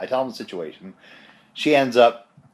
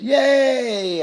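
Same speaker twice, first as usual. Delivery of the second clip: slow, high, drawn-out yay; excited cheer; 5 dB louder than usual. Real, not acted. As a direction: cheer it like a little win. Celebrating a passed test.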